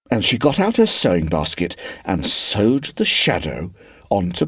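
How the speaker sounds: lively storybook reading with voiced characters; story narration. A narrator's reading. Reader telling Wendy and Peter's meeting in storybook voice. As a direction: read it like a bedtime story for a class.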